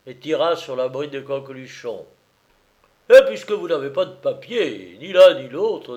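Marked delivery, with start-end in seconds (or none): 3.1-6.0 loud, high, thundering officer; forceful gendarme outburst; terrible voice. A narrator's reading. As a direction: thunder it at the suspect. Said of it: "dit-il d'une voix terrible".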